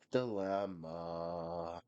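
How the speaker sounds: low, very slow dramatic word; deep dramatic. Shown as a demonstration. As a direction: drop low and stretch it out. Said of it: "Here's my dilemma".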